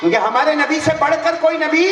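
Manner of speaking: high, loud preaching shout; climactic shout. Real, not acted. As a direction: cry it out to the crowd at full force. (ऊँची, ज़ोरदार प्रवचन की पुकार)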